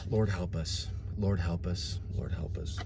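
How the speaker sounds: dropping to a near whisper, pleading; hushed prayer. Real, not acted. Preacher whispers a plea to the Lord after ranting. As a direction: pray it low and broken. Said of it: "quiet repeated prayer".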